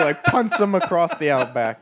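ordinary talk

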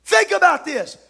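rising, shouted preacher's cadence; full-force preaching. Acted. Preacher to his congregation, full-force preaching. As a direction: drive it home to the pews.

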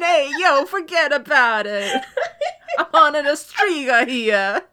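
hammed-up New Yorker "forget about it"; New Yorker accent. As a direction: lay on a thick New York accent while cracking up. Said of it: "this time we got a New Yorker hunter".

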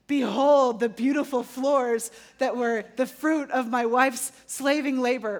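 grand proclamation. Retold or imitated. Wife imagining her husband praising the floors, slow pompous herald. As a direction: announce it like a royal decree. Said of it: "he doesn't talk like that".

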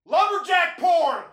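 loud, high, drawn-out holler; imitated yell. Host mimicking his son hijacking a voice command, yelling. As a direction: yell it like a kid across the room. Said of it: "my son would yell from across the room".

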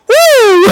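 loud high whoop; joyful whoop. Real, not acted. Preacher whooping in joy at the congregation. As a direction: let out a happy woo. Ecstatic shout in sermon.

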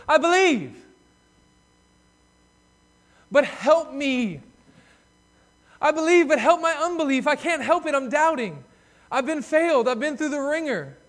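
loud, high, pleading cry; desperate cry. Acted. Father begging Jesus for his son, desperate loud plea. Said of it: "the wording is emphatic".

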